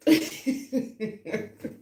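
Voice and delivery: high breathless burst of laughter; laughing at the joke she's retelling; real laugh. Real, not acted. Host cracks up retelling the couch joke, breathless laugh. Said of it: "I'm laughing".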